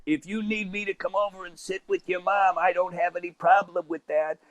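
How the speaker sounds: lightly voiced smoker calling from the background; mock yell without much volume; smoker's voice. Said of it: "she had that smoker's voice".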